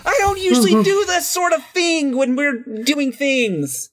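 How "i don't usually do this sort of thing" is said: excited. Real, not acted. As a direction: announce the win with glee. Proud cry after success.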